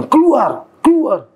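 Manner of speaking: loud, high shouted order to get out; thug's shout. Retold or imitated. A thug ordering the speaker out of the mosque, loud shout. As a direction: bark it like a thug throwing someone out.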